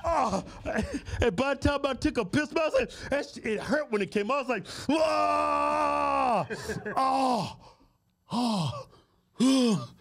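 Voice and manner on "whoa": loud high yelp; mock yelp of pain. Storyteller reliving the painful first pee, loud mock yelp. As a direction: cry out like it burns.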